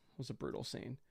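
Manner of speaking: breathy whispered aside, voice dropped away. Shown as a demonstration. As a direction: hush it as if to yourself. Repeats the phrase, then whispers it.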